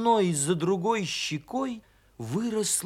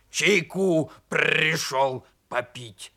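slow, growly creature voice; hoarse croak; 3 dB louder, slower than usual. A narrator's reading. Donkey asking bear cub for tea, hoarse. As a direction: rasp it out, rolling the r hard. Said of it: "прохрипел ослик".

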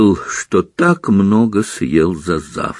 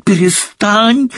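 hysterical cry; 11 semitones higher, slower than usual. Acted. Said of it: "замахала руками Гермиона в истерике".

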